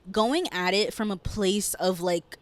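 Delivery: ordinary talk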